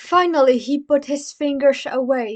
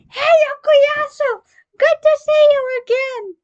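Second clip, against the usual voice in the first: much higher, louder bright call; loud cheerful greeting; 14 semitones higher, 9 dB louder than usual. Acted. Koichi greeting Okuyasu with a loud, much higher call. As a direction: hail a friend across the street.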